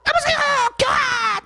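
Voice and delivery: put-on high, calling down an old crank phone line; father ringing the exchange on a crank phone, calling out high; calling the operator.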